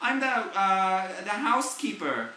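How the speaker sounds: mock housekeeper voice; imitating housekeeper. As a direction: drag it out cheerfully. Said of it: "she's like".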